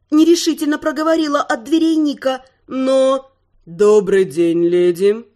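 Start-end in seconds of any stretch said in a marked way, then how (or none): 3.7-5.2 low, slow, drawn-out menacing baritone; slow theatrical villain menace. Acted. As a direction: draw it out, low and sinister. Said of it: "зловеще протянул красивый мужской баритон".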